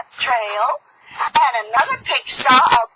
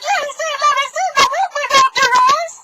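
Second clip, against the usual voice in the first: very high piggy begging; little pigs pleading; 13 semitones higher, faster than usual. Acted. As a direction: beg high like small pigs. Pigs beg at the door.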